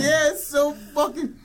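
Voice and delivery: helpless laughter at a dumb movie; laughing. Real, not acted. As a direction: crack up mid-sentence. Cracking up at the scene.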